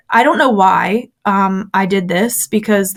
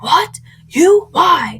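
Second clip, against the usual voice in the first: high, startled questions, each word jumping up; shocked disbelief; 8 semitones higher, much slower than usual. Retold or imitated. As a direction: play the pastor hearing something he can't believe. Pastor shocked at her confession.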